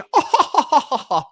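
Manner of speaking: high, loud burst of laughter; exasperated laugh. Real, not acted. Streamer fed up with world politics, exasperated laugh.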